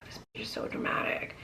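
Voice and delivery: hushed, breathy whisper of disgust; muttered aside. Retold or imitated. Husband muttering about his wife, whispered. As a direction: mutter it under your breath, fed up. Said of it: "And he's like".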